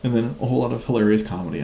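ordinary delivery

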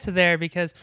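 ordinary talk

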